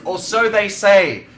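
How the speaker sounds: high, loud, slowed proclamation; mock dramatic. Presenter casting playful doubt on a claim, mock dramatic. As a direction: declaim it grandly, drawn out.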